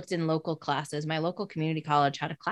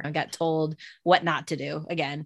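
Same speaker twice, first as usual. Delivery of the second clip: usual speaking voice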